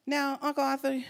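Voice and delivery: usual speaking voice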